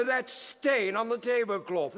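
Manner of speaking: usual speaking voice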